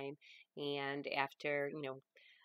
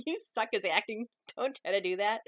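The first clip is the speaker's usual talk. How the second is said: mock heckler; 6 semitones higher, 8 dB louder than usual. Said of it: "and you'll be like".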